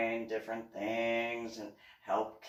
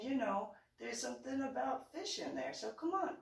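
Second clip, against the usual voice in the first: much higher, playful pleading with a laugh; coaxing mom; 12 semitones higher, 3 dB quieter than usual. Retold or imitated. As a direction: persuade him like a sly mom. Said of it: "I'm like".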